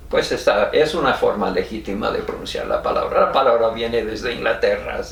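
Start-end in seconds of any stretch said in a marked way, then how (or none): none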